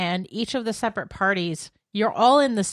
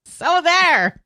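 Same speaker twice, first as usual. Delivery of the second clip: mock triumphant; 10 semitones higher, 9 dB louder than usual. Real, not acted. Teasing triumph.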